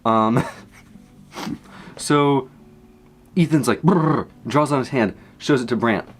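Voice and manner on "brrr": quick trilled "brrr"; higher-pitched vocal whirr; imitated noise. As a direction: make a short, rolling sound effect.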